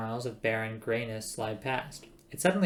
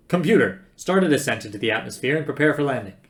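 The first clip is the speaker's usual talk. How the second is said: loud, urgent order; commanding; 9 dB louder than usual. Acted. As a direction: order the computer briskly at volume. Zaphod barks an order.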